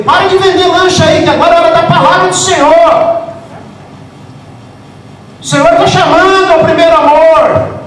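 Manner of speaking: bradando alto, como quem interrompe a cantina; proclamação gritada. Retold or imitated. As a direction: grita a ordem como pregador inflamado. Pastor acting his own call-out. (grito de pregador chamando a cantina)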